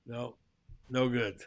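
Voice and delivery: ordinary delivery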